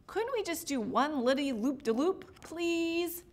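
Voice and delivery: eager wheedling with a high final please; coaxing little reindeer; pleading. A narrator's reading. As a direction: beg for a treat. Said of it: "pleaded Rodney".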